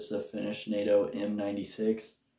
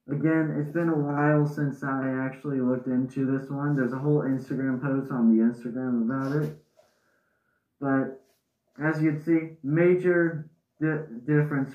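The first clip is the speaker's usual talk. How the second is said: muffled behind respirator; speaking through gas mask; 4 semitones higher than usual. Real, not acted. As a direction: voice inside full face mask. Seen 1: Speaker wears full-face gas mask throughout; references show bare face.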